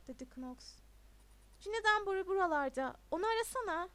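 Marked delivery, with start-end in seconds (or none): 1.6-3.9 loud, high party shout; louder, higher, brushing someone off; raised voice. A narrator's reading. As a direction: call it over loud music. Talking over loud party music.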